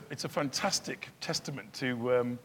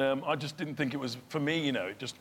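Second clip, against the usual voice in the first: ordinary delivery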